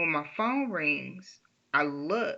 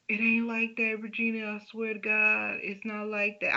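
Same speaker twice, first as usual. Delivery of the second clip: pleading husband; 5 semitones higher than usual. Retold or imitated. Husband denies, voiced by wife.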